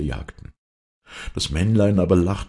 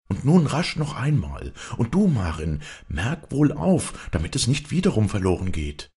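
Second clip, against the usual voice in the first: urgent widow; 6 semitones higher than usual. A narrator's reading. Widow urges repeating the rhyme.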